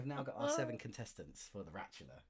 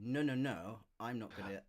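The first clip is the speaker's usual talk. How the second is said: grumpy computer voice; 18 dB louder than usual. Pretend. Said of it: "and it's saying".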